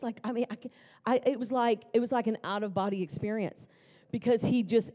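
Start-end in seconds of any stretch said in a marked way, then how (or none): none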